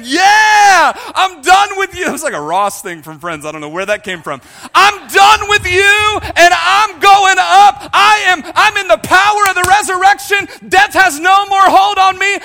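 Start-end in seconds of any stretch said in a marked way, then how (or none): 0.0-2.1 high, very loud declaration, building; triumphant defiant shout. Acted. Newly baptized believer renouncing the underworld, shouting. 4.7-12.4 triumphant defiant shout. Acted. Believer declaring resurrection power over death, triumphant shout.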